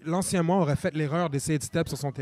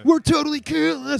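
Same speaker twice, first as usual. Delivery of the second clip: higher, louder hammy anime shout; over-the-top English dub; 11 semitones higher, 10 dB louder, slower than usual. Retold or imitated. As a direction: ham it up like a cheesy dub. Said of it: "C'est toujours over".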